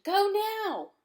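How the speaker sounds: high, loud command to go; urgent shout. Acted. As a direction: push him to run now. Urging friend to flee.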